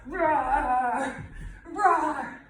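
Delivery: loud, growled playful roar; loud lion roar. Shown as a demonstration. Teacher roars loudly while walking the mat like a lion. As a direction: roar out big as you stride. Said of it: "and to the back".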